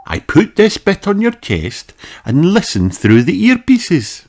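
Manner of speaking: high, friendly doctor; kindly doctor greeting a child; Doctor Polar Bear voice. Acted.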